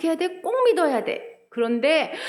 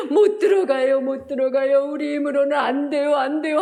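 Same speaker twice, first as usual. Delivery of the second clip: mock crying protest; 3 dB louder than usual. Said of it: "하면서 막 울다가".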